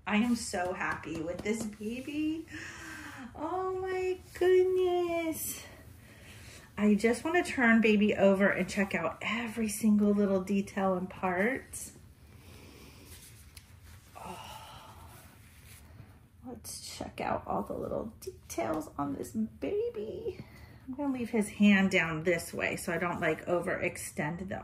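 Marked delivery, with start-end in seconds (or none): 3.4-5.6 high, slow cooing; drawn-out high coo. Real, not acted. Cooing over doll. 14.2-20.4 soft high whisper; hushed gentle whisper. Real, not acted. Whispering to viewers.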